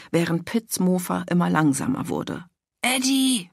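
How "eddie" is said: a calling yell, pitched high; narrated yell. A narrator's reading. Pitt yelling after Eddie on the moped, high-pitched. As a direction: call out after his friend. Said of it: "brüllte er".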